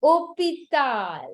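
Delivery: hôpital slowly, silent h and no s, long final "al"; French pronunciation. Shown as a demonstration. Teacher giving the French word, hôpital drawn out with silent h. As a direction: say hôpital as "oh-pee-TAHL". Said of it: "But in French, it is".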